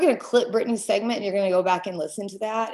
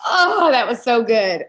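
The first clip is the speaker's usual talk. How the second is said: choked-up laugh through real tears; fighting back happy tears while laughing; teary laughing; 4 dB louder than usual. Real, not acted. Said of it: "choking back tears".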